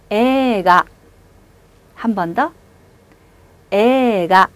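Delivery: 에가 with 에 held long; 에가, stretch the 에; long vowel. Shown as a demonstration. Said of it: "어떻게 읽는다고요". (에가 에를 길게)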